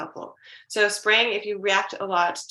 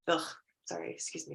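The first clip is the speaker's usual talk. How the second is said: coughing on dust; 8 dB quieter, faster than usual. Real, not acted. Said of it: "Got, like, dust in my throat".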